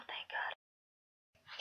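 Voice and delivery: whispered aside to self; whispered relief. Real, not acted. Host relieved to reach the last character, whispering. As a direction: drop into a relieved whisper, almost inaudible by the end.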